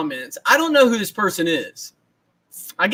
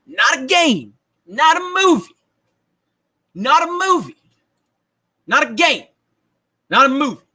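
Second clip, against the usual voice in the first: Allen Iverson rant; 6 semitones higher than usual. Retold or imitated. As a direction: hammer each line like the famous press conference. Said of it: "the new Allen Iverson".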